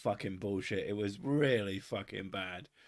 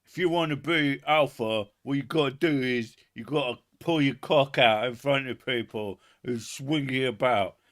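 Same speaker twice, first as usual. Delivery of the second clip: alpha guru voice; 4 dB louder than usual. Retold or imitated. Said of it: "more stuff like".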